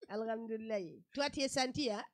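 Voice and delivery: lightly voiced Malian accent. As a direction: greet like a Malian auntie. Mimics Malian greeting.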